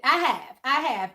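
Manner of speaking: ordinary talk, nothing marked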